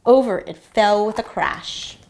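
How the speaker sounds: ordinary delivery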